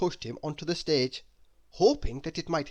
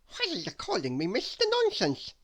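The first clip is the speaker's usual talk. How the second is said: lightly voiced confused character; 7 semitones higher than usual. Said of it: "said Mister Nonsense".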